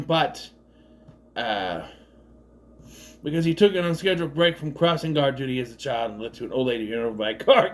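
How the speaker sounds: ordinary delivery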